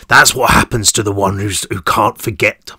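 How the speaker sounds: sneering thug with a grin; grinning menace. Acted. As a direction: menace him through a grin. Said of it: "through his shark's grin".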